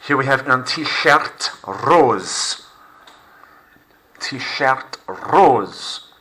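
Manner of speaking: "un t-shirt rose" slowly, rose as roz; slow, clear model for repeating; slow model phrase. Shown as a demonstration. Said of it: "Repeat after me".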